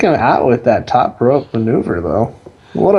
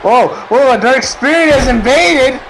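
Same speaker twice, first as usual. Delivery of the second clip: excited; 12 semitones higher than usual. Acted. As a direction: shout it like a shocked ringside caller. Surprise invader during match.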